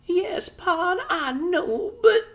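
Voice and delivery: very high anxious mother; a tiny wife giving in; Homily's voice. A narrator's reading. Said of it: "Homily to Pod".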